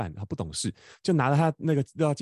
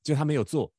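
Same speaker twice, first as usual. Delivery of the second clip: ordinary delivery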